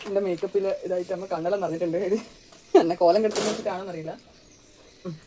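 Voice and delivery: ordinary talk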